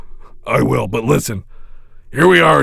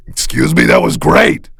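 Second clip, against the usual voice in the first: usual speaking voice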